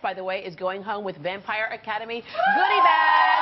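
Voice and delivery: loud, upbeat studio sign-off; TV host promo. Acted. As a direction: sell it to the audience.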